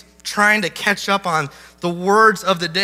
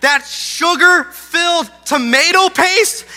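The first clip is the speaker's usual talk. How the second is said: mock disbelief; 7 semitones higher, 3 dB louder, slower than usual. Disbelief at son's answer.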